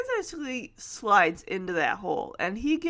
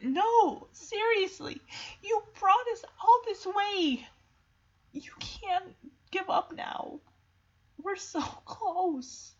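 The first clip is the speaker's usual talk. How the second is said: high, emotional appeal; pleading, softening toward the end; desperate pleading; 7 semitones higher than usual. Acted. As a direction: beg a friend not to quit. Pleading with Shadow not to quit.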